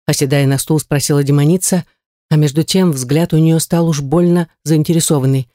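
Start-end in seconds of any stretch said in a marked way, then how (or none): none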